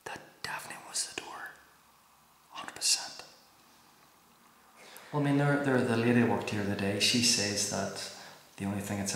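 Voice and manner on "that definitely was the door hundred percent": breathy hushed whisper. Real, not acted. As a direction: whisper it, convinced. Hushed after knock.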